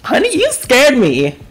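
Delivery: high, indignant outcry after a fright; shaken scolding. Real, not acted. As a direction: scold whoever just startled you. Scolding game after jump scare.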